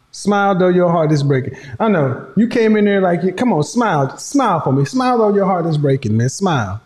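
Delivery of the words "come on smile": ordinary talk, nothing marked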